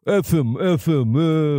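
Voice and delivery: put-on, repetitive tough-guy grumble; Robert De Niro. Host mocking the actor who lost his mind, put-on impression. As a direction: lightly imitate a grumbling movie actor. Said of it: "he just has to say".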